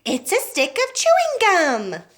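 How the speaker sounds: high, loud and slow thrilled cry; excited shriek. A narrator's reading. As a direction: stretch it out, thrilled by the gum. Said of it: "she shrieked".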